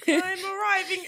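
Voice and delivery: high, slow proclaiming shout; town crier shout. Pretend. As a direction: cry it out like a herald.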